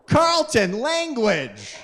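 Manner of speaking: high, sharp mother's rebuke; scolding mom. Retold or imitated. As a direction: snap the name like a shocked wife. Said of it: "my mom would be like".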